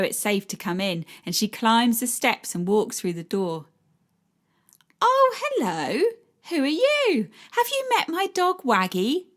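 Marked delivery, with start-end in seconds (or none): 5.0-9.3 put-on talking to a small child; sweet high greeting to child. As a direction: coax a shy boy gently. Narrator voices herself sweetly to child.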